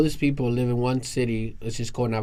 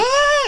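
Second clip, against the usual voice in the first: high, loud startled shriek; gasp-scream at a ghost; shocked scream; 8 dB louder than usual. Retold or imitated. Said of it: "the lady was like".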